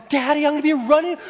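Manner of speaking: put-on high, fast little-boy voice; imagined young son. Father imagining son running with the ball, high child voice. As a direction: rush it out like a child to his daddy. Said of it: "in his arms and say".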